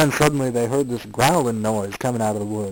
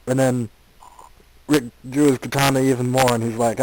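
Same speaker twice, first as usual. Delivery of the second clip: ordinary delivery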